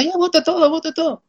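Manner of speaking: esposa impaciente, voz mucho más aguda; esposa imitada. Retold or imitated. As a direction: imitando a la esposa que ordena botarlo todo. Esposa imitada, ordena botar. (esposa imitada, impaciente y muy aguda)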